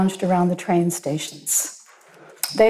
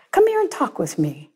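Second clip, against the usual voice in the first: put-on friendly old man; 8 semitones higher than usual. A narrator's reading. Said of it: "beckoning to the drunk".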